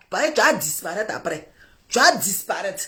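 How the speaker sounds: plus grave et plus doux, insinuant; mari soupçonneux. Retold or imitated. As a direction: glisse le reproche à voix basse. Voix grave imitant le mari. (mari soupçonneux, voix grave et basse)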